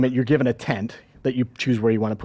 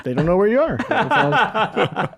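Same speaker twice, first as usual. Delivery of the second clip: laughing; 8 semitones higher than usual. Real, not acted. Joking that dodging debt isn't fraud.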